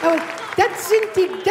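usual speaking voice

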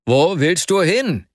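lauter, langsamer Ruf; Schrei. A narrator's reading. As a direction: ruft ihm erschrocken nach. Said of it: "schrie Arkadi Iwanowitsch an". (laut gerufene Frage an Vasja)

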